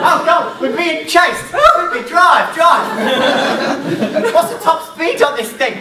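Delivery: high urgent shouting, laughing; mock panic. Chased on the moped, urging partner to drive, panicky shout. Being chased, improv scene.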